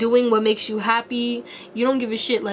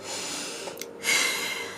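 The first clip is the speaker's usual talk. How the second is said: long soft exhale; sigh; 10 dB quieter than usual. Real, not acted. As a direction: let out a slow breath. Searching for words mid-reading.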